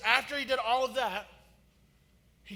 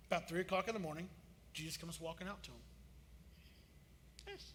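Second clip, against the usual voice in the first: usual speaking voice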